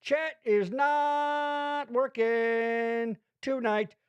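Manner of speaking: slow, drawn-out declaration; slow emphatic. Acted. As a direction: spell it out slowly.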